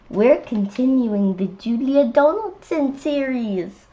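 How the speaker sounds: ordinary delivery, nothing marked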